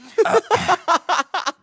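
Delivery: high burst of laughter. Real, not acted. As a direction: crack up at the punchline. Laughs at own story.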